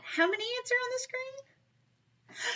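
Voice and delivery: very high, drawn-out question; sing-song prompting. Shown as a demonstration. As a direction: stretch it out high to coax a bigger answer. Re-asks children for louder answer.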